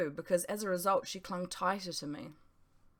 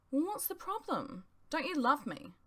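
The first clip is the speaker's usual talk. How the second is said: lightly voiced hurt girlfriend; 8 semitones higher than usual. Girlfriend asks if he loves her.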